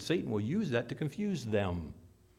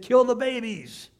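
Herod's order; 9 semitones higher, 9 dB louder than usual. Acted.

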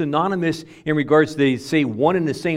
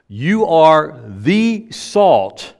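very slow and louder, "the" held as "thee"; stress on THE; 5 dB louder, much slower than usual. Shown as a demonstration. Preacher repeats the article point, stressing "the" as "thee". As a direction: hit each word, stretching "the" into "thee". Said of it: "It's the definite article here".

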